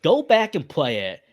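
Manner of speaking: normal talking voice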